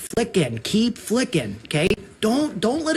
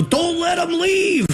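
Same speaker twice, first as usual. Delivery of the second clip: mock captor; 10 semitones higher, slower than usual. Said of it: "lock the door and go".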